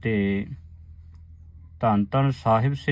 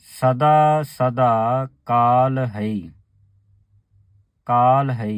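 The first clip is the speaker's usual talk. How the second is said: ਸਦਾ ਸਦਾ ਕਾਲ ਹੈ chanted slowly and louder; slow, louder recitation; 4 dB louder, slower than usual. Shown as a demonstration. Teacher showing the sangat how to recite ਕਾਲ ਹੈ. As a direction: proclaim ਸਦਾ ਸਦਾ slowly and firmly. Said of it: "ਇਸ ਤਰ੍ਹਾਂ ਪਾਠ ਉਚਾਰਨ ਕਰਨਾ ਹੈ".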